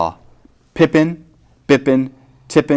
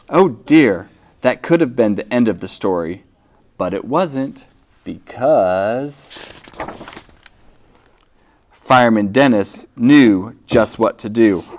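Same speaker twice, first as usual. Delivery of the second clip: ordinary delivery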